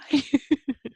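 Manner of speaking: a high, teary laugh through emotion. Real, not acted. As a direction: laugh while holding back tears. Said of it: "making me cry".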